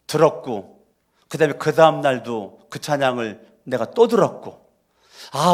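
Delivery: ordinary talk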